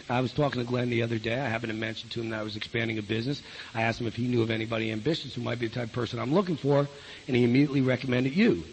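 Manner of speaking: low, soft, quick referral pitch; low-key, brisk phone script; phone technique. Shown as a demonstration. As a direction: slip the pitch in quietly and smoothly, voice down. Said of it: "That's technique, right?".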